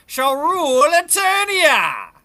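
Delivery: rising, loud triumphant shout; villainous proclamation. Acted. Villain vowing to rule Eternia, ringing shout. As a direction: build to a grand villain's cry. Villain declares his ambition.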